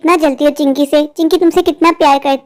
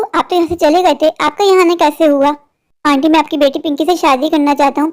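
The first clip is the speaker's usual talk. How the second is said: usual speaking voice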